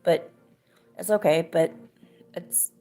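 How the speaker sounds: mom softly forgiving, sad at the end; mother consoling her son, softly sad; gentle sad. Retold or imitated. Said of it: "my mama was just like".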